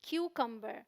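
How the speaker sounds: क्यूकम्बर said as "KYU-kum-ber"; softly modelled क्यूकम्बर, short "uh" in the middle; correct pronunciation. Shown as a demonstration. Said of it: "तो एक का ऊ sound है".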